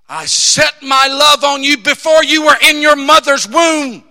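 rising to a loud fervent shout; impassioned cry. Acted. Preacher voicing Jesus crying out his love. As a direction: proclaim it with full passion.

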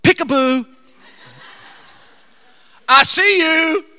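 sudden booming peekaboo; playful loud call. Acted. The Lord finding someone who hides from him, loud teasing call. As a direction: call out loudly, catching someone hiding.

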